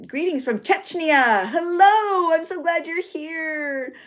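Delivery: high, drawn-out delighted welcome; excited greeting. Real, not acted. Host greets a viewer from Chechnya, excited high welcome. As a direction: sing out a big hello, voice climbing high.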